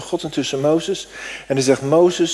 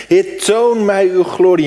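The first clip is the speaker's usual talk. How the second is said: proclaimed theme; 5 semitones higher, 6 dB louder than usual. Acted.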